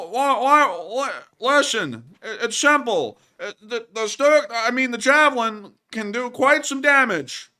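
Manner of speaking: high, loud, halting comic voice; hammed-up stammering explainer. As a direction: insist in mock exasperation, tripping over words.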